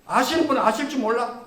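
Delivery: normal talking voice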